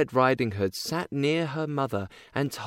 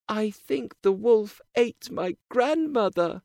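sad; 9 semitones higher than usual. A narrator's reading. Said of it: "but she was sad".

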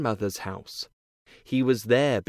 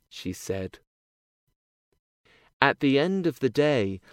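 plain reading voice

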